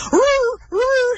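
high howling roo; dog calling. Acted. Dog crying out for his family, high howl. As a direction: call out like a lonely little dog. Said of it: "he called again".

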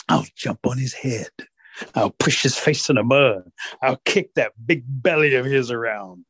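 slow, deliberate scheming; plotting rabbit. Acted. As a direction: relish each step of the revenge.

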